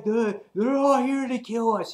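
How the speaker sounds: high-pitched, worked-up warning; hammed-up paranoid protest; mock alarm. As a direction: act out a character crying danger. Voices character warning of attack.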